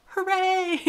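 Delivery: high, loud playful hooray; mock cheer. Coach cheering an already finished to-do, mock triumph. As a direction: celebrate a tiny win, hammed-up.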